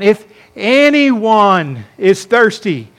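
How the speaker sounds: "anyone" stretched out slowly and punched; stress on anyone. Real, not acted. Preacher pointing out the pronoun to listeners, drawn-out stress on "anyone". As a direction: lean long and hard on "anyone". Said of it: "It's the indefinite pronoun".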